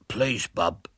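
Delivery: lightly put-on throaty voice; a boy faking a slum lad's voice; deep throaty voice. A narrator's reading. William disguised as Bert at the door, mild throaty voice. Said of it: "began William in a deep, throaty voice".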